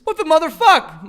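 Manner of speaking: loud, high outburst; mock reprimand. Co-host jokingly scolding the kids, loud mock outrage. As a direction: burst out in pretend parental outrage.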